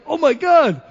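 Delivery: loud, high mock dismay; retold panic. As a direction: blurt it out in mock dread. Retells panic as reading turn approaches.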